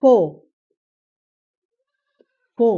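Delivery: ফো with a strong aspirated ph onset; aspirated ph sound. Shown as a demonstration. Teacher modelling ফো for students, aspirated. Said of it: "তারপরে যদি বলি যে".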